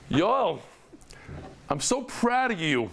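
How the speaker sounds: high, drawn-out mock gushing; over-eager pride. Bumping into his fifteen-year-old son, gushing high and slow. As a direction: replay an overdone burst of fatherly pride.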